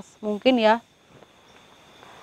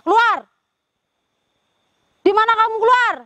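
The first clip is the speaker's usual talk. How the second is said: high, loud shout into the dark; shouted command; 11 semitones higher, 4 dB louder than usual. Real, not acted. Ghost hunter yells at the spirit in the forest, high loud shout. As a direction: bark the order out to the hidden spirit.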